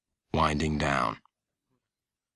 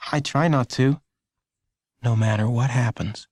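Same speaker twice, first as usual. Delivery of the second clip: put-on young Lenny; 4 semitones higher than usual. A narrator's reading. Lenny answering mother.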